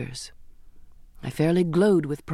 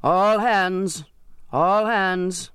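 bellowed call; 2 semitones higher, much slower than usual. A narrator's reading. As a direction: roar it across the deck. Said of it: "and at the rail bellowed".

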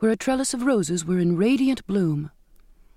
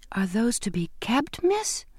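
rising puzzled question, softer; 5 semitones higher, 4 dB quieter, slower than usual. A narrator's reading. Maid puzzled by the sailor's clothing, rising question. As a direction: lift the end in confusion. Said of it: "Bridget asked in puzzlement".

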